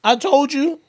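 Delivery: playful God calling him knucklehead; voicing God. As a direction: speak as the Lord scolding gently. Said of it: "I'm like".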